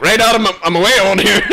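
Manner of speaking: high, loud mock grandad brushing it off; lightly voiced old man hurrying off the phone, laughing; awkward old granda. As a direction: grandson imitating granda's escape from the call. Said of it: "He's like".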